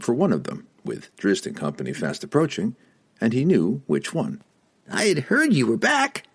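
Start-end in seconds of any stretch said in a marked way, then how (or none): 4.9-6.3 high squeaky little voice; squeaky halfling voice. Acted. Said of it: "squeaked a diminutive chap".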